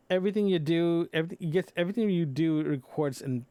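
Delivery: ordinary talk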